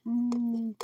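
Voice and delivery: high hum of delight; pleased high food hum; mmm of enjoyment. Real, not acted. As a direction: savor the taste with a high mm. Savoring peanut butter while eating.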